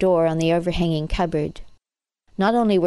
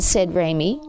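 ordinary delivery

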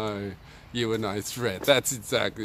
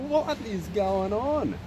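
baffled; 9 semitones higher, slower than usual. Retold or imitated. Baffled by cops not testing.

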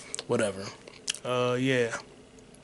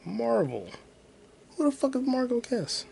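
ordinary talk

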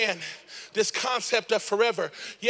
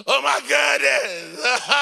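hammed-up, drawn-out grief with higher pitch; mock wailing; 3 semitones higher, slower than usual. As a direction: wail theatrically, half laughing. Acts out Martha's grief-stricken wailing.

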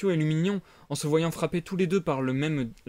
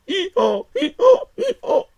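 very high braying hee-haw; donkey bray; 10 dB louder, slower than usual. Acted. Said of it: "des braiements d'âne". (braiement d'âne aigu)

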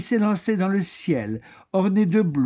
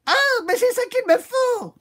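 very high, thrilled squeal; excited cry; 3 dB louder than usual. Acted. Lady Chameleon squeals at the elephant's grey suit. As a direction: gasp of delight at the elephant's grey. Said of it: "s'écria dame Caméléon".

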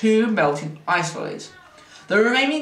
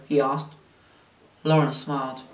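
ordinary delivery, nothing marked

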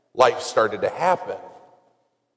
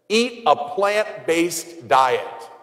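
each word laid out slowly like a decree; slow quoted advice; 3 semitones higher, much slower than usual. Retold or imitated. Priest quoting a documentary's diet advice, slow and deliberate. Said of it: "Their answer was this".

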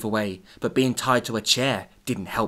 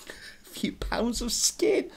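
whisper; 11 semitones higher, 6 dB quieter, much slower than usual. Real, not acted. Savouring gruesome line.

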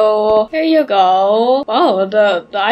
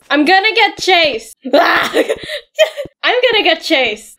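laughing fearful wail, pitched up; half-laughing dread of the chase; mock panic; 5 semitones higher, slower than usual. Real, not acted.

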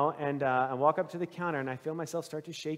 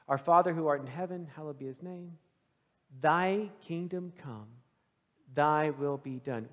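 reverent prayer recital; 4 semitones lower than usual. Acted.

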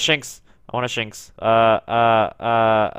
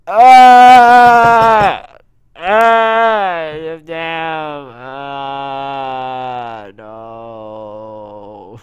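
real gamer anguish, fading; anguished wails; 2 semitones higher, 14 dB louder, much slower than usual. Real, not acted. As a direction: let the despair drain out. Despair after failure.

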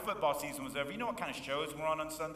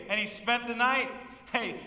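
normal talking voice

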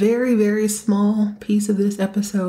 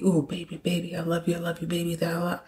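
soft mock lovey-dovey; 6 dB quieter, faster than usual. Mock lovey phone call.

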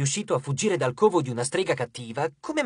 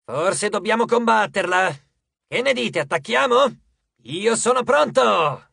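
louder, higher rallying cry; battle-eager coach; 6 semitones higher, 5 dB louder than usual. A narrator's reading. Coach proposing a fight to the crew, excited and eager. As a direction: rouse them to attack, stretching the last words.

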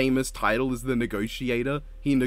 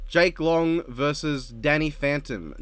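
ordinary talk

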